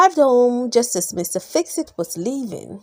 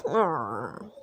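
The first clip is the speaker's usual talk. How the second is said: low, quiet fed-up growl "Arr"; disgusted growl; 10 semitones lower, 11 dB quieter than usual. Acted. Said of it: "He was disgusted".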